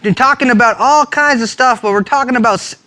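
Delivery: high, drawn-out, hollering show opener; mock over-excited TV host, loud and stretched; hammed-up host voice. Host welcoming viewers to Rock It Up, hammed-up. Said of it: "why am I talking like this?".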